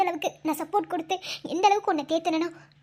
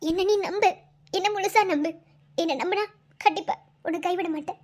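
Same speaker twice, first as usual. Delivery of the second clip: normal talking voice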